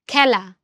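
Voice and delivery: খেলা as "khæla", set apart; open "ae" vowel. Shown as a demonstration. Teacher testing examples, খেলা with open "æ". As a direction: widen the "e" of খেলা to "æ". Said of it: "এর উচ্চারণ স্বাভাবিক না".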